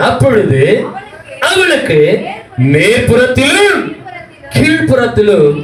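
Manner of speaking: high, drawn-out proclamation; declaimed scripture reading. Real, not acted. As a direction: read it out high, stretching the phrases. Dramatic scripture reading to congregation. (உயர்ந்த குரலில் நீட்டி வேத வாசிப்பு)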